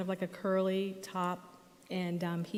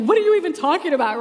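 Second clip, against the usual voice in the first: much higher, louder fast yell; screaming; 8 semitones higher, 14 dB louder, much faster than usual. Retold or imitated. Son screaming back at mom in the car, fast loud yell. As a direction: hurl it back at her in a rage. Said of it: "So he's screaming at me".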